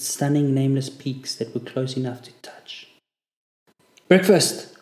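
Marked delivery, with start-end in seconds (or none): 4.1-4.8 sharp loud barked call; barked order. A narrator's reading. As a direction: bark it like a drill corporal. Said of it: "Corporal Borden barked".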